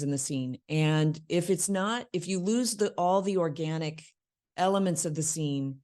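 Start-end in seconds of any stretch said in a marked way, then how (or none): none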